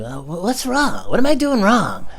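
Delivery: whiny. Retold or imitated. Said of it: "I'm like".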